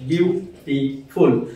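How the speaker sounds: "বিউটিফুল" very slowly in three separate syllables. Shown as a demonstration. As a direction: sound out "বিউটিফুল" as বিউ, টি, ফুল. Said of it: "তিনটে সিলেবলে আমরা ভাঙতে পারছি".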